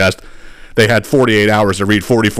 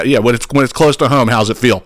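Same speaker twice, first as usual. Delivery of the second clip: ordinary talk, nothing marked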